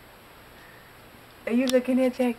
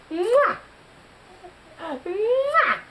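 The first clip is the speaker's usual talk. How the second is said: kiss sound; 7 semitones higher than usual. Real, not acted. Kissing sounds to baby.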